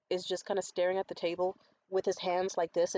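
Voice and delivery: normal talking voice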